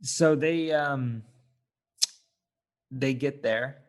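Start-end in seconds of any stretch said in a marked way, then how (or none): none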